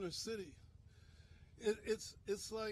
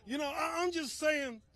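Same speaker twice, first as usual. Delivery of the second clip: real feeling breaking through, raised and loud; emotional outburst; 5 semitones higher, 5 dB louder than usual. Real, not acted. Minister at a gun violence rally, overcome, loud emotional outburst. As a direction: voice surging loud and high with emotion. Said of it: "I'm a little emotional".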